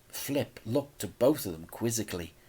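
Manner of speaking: ordinary delivery, nothing marked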